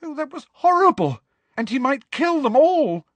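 high loud upset cry; frightened cry. Acted. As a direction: cry out in fear. Hermione quaking in fear.

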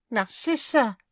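very high, thin squeak; squeaky voice. A narrator's reading. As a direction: squeak the name like a rat. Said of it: "he said in a squeaky voice".

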